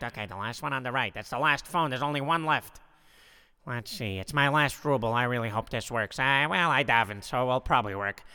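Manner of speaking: Harvey talking to himself. Acted. Harvey with his last ruble, low self-talk.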